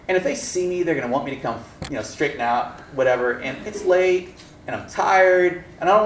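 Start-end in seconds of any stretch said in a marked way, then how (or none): none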